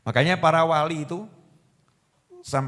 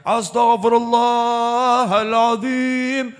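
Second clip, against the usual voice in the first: long, drawn-out call of astagfirullahaladzim; imam calling out; 4 semitones higher, much slower than usual. Retold or imitated. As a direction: stretch the prayer out slowly like an imam leading. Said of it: "Imamnya udah teriak-teriak". (imam melantunkan istighfar dengan panjang dan lambat)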